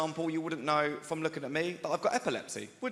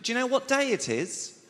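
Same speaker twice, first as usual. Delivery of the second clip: high polite woman; 9 semitones higher, 3 dB louder than usual. Retold or imitated. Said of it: "She went".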